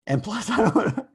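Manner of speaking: words breaking into a high laugh. Real, not acted. Lecturer imagining complaints about a class server, laughing. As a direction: start speaking, then crack up.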